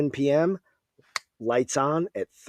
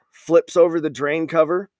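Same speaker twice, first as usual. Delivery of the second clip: usual speaking voice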